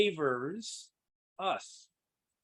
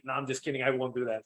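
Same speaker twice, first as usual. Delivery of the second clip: ordinary delivery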